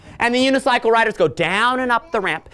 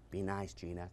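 gentle hush to puppet; 13 semitones lower, 22 dB quieter than usual. Acted. Soothing the goose puppet.

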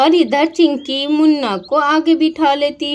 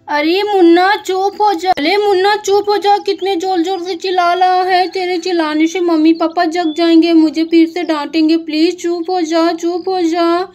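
urgent hushing; 4 semitones higher, 3 dB louder than usual. Acted. Sister begging brother to hush, urgent and raised. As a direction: beg him to stop before parents wake.

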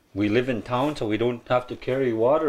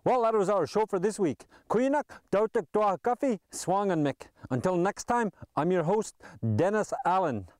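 bright, higher host voice closing the show; radio sign-off; 7 semitones higher, 4 dB quieter than usual. Acted. Radio host thanking guests and introducing the last song, upbeat announcer.

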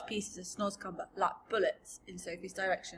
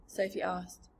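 ordinary delivery, nothing marked

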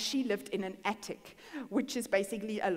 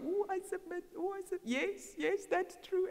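high, small, yielding voice; mock-feeble surrender, pitched way up; mock meek submission; 8 semitones higher than usual. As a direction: give in helplessly, high-pitched. Mimics giving in to lies.

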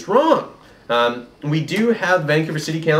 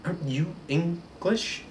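quiet; 3 semitones higher, 10 dB quieter than usual. Retold or imitated. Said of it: "not think".

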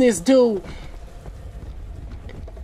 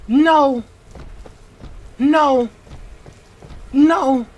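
ordinary talk

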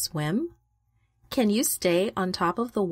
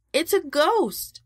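higher, slower cry of fear at a ghost; frightened shout; 8 semitones higher than usual. A narrator's reading. Disciples in the storm-tossed boat, terrified shout. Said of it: "they shouted".

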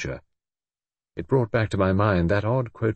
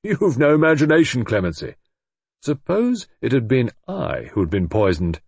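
half joking; 4 semitones higher, 4 dB louder than usual. A narrator's reading. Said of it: "half jokingly".

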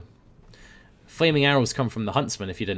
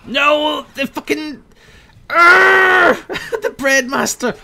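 much higher, louder cry of outrage; frustrated yell; 10 dB louder, much slower than usual. Real, not acted. Gamer robbed of his taunt kill, frustrated yell. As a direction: howl as the kill is stolen.

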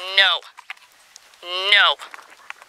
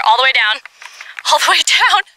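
rising, much higher and louder coaxing; excited urging; 8 semitones higher, 6 dB louder than usual. Real, not acted. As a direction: coax the dog down eagerly.

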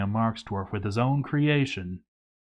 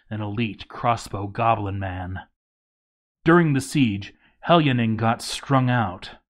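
ordinary delivery

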